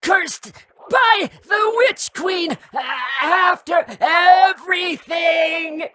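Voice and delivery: very high creature shriek; anguished screaming. Acted. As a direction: wail every word at the top of the pitch range. Parasite wailing at betrayal.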